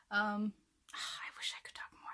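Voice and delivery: whispered with a sigh; soft whispered musing; whispering. Real, not acted. Whispering wish.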